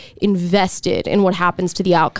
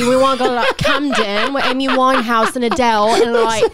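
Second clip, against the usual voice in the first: higher, hammed-up London English accent; British accent; 4 semitones higher than usual. Co-host asked to do British, London accent. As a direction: show off a put-on British accent. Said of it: "Do British".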